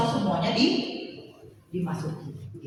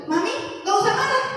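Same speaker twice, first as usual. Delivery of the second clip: put-on very high, louder child's plea; imitated daughter; 12 semitones higher, 4 dB louder than usual. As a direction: imitate a daughter pleading with mom. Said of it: "Anak saya itu yang perempuan suka gitu". (menirukan anak perempuan, nada tinggi memohon)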